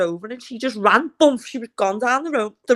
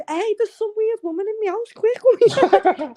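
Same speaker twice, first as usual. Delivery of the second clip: put-on high, urgent wake-up call to the girls; alarmed call; 9 semitones higher, much slower than usual. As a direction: rouse them in a rush, pitched up.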